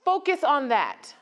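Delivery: normal talking voice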